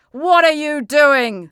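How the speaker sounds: loud, high, slow roar. A narrator's reading. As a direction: roar over the noise. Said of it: "it roared over the mixer".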